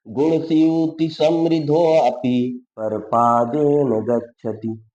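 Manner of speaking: slow, loud chanting recitation of the shloka; Sanskrit verse recitation. Acted. Teacher reciting a Sanskrit shloka to students, slow and loud. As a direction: intone each line slowly and loudly, like a recitation.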